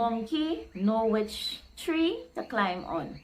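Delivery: "Monkey know which tree to climb on" spoken slowly; slow heading. Shown as a demonstration. Teacher introducing the next proverb, slow and deliberate. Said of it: "saying read as a title".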